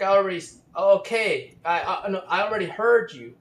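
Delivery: loud, high retold yell of irritation; imitated angry outburst. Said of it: "he said really angrily".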